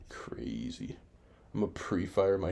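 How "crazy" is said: breathy whispered aside. Real, not acted. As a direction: whisper it under your breath. Quiet aside to viewers.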